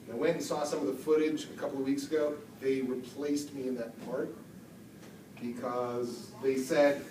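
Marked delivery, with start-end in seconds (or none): none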